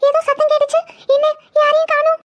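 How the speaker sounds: high, halting, tearful murmur; acted trembling worry; shaken fear. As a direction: come round frightened and confused. Waking shaken after ghostly fright.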